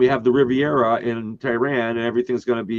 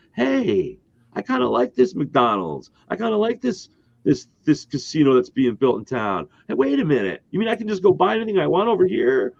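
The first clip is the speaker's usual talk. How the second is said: high, hammed-up Italian accent; mock Italian voice of an Iranian guard; 8 semitones higher than usual. Former guard enjoying freedom, in a hammed-up Italian accent. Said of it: "I'll do my Italian accent again".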